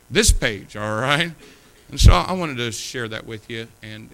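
loud, punched preaching; preaching emphasis. Real, not acted. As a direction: hammer the point home. Pounding the pulpit point.

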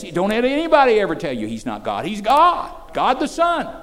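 loud, ringing pulpit proclamation; preaching at full force. Acted. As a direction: preach it out to the room. Climactic declaration.

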